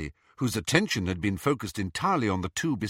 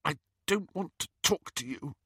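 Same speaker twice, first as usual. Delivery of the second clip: high, tight, tearful voice; constricted voice; 7 semitones higher, 4 dB quieter than usual. A narrator's reading. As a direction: force the words out through a tight throat. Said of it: "she said in a constricted voice".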